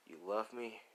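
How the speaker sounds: soft mock chuckle before speaking; little chuckle. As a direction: give a small acted chuckle, then ask. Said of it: "there's a little chuckle".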